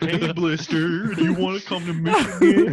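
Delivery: slow, sweet-talking the spider; coaxing a pet, drawn out and laughing. Acted.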